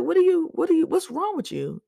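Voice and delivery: high, loud exasperated cry. Retold or imitated. Thinking about the annoying shift leader, high exasperated. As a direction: squeal it in disbelief.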